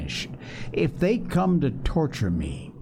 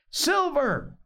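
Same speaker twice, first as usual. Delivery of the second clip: calling out. A narrator's reading. Doctor summons the cook, calling out high. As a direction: hail him on a high, falling note. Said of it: "he cried".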